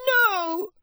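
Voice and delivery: piercing high elf shriek. A narrator's reading. Elf shrieks at her master, piercing and high. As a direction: scream out in horror. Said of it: "shrieked Winky".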